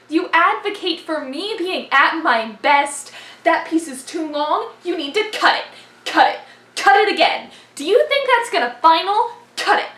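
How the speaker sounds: building to a loud, high-pitched shout; intense slam poem. Acted. Performer throwing forensics' harsh demands back, building to shouted recitation. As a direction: drive harder with each line until shouting.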